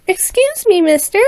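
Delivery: put-on high little girl voice; little filly. A narrator's reading. Filly tugging at Vader, high and small. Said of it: "she said with a frown".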